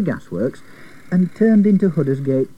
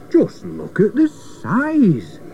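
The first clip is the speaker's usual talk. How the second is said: higher, very drawn-out exclamation; amazed shout; 5 semitones higher, much slower than usual. A narrator's reading. George sees the giant wheel, an amazed shout. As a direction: stretch the words out in amazement. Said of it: "shouted George".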